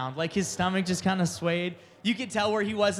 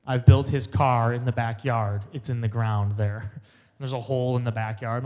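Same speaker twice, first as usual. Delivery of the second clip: dad quote; 7 semitones lower, 5 dB louder than usual. Retold or imitated. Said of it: "Dad's like".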